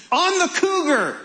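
high-pitched mock yell from the crowd; high, comic call-out; imitated yell. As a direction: blurt it out high, mimicking an eager heckler. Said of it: "some poor guy yells out".

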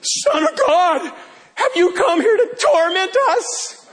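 hammed-up demon shout. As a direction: shriek it like a cornered demon. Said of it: "and those demons shout".

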